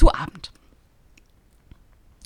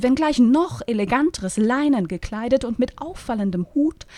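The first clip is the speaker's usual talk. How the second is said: usual speaking voice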